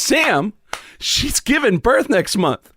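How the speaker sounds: high, slow retold reproach; scolding. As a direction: spell out the reproach, high and dragged. Retold crowd scolding Sam.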